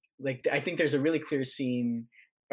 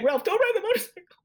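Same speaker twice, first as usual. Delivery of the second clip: high-pitched, louder mock fretting with a laugh; play-acted panicky plea, pitched way up; worried warning; 5 dB louder than usual. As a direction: squeal it like an overprotective relative, half laughing. Acted warning to reckless young Ralph.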